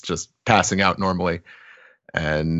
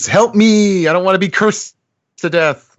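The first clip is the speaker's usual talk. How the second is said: much higher, louder frightened cry; distant cry for help; 12 semitones higher, 4 dB louder than usual. Acted. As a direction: scream for help from far off. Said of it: "we hear in the distance".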